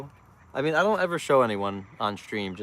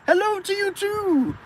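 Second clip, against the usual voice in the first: very high, slow singsong hello; high, slow mock greeting; mocking reply; 4 dB louder, slower than usual. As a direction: mimic a driver calling back. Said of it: "They're gonna be like".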